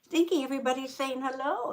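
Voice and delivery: ordinary talk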